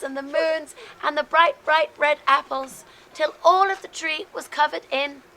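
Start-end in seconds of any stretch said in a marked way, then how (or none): none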